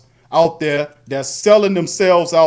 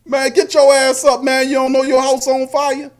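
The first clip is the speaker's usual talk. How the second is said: higher-pitched, exasperated warning; mock urgent wake-up; 6 semitones higher than usual. Host pounds a neighbour's door over fire, mock urgent. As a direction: rouse a sleeper in pretend urgency. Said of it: "I'm banging on that, kicking on the door".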